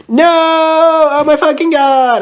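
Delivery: high mock-terrified cry; scared shout. Narrator crying out in a bad dream, scared shout. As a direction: wail it high in hammed-up fear. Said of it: "I shouted in a scared voice".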